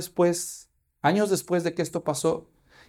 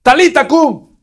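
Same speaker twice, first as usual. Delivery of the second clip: loud command; 9 semitones higher, 12 dB louder, slower than usual. Acted. Preacher repeats Jesus' command.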